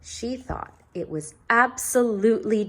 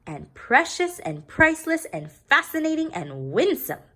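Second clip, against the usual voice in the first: higher, louder gushing; doting father; 10 semitones higher, 4 dB louder than usual. A narrator's reading. Father praising her name, gushing. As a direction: heap on the praise. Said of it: "said her father".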